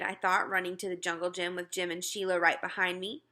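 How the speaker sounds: plain reading voice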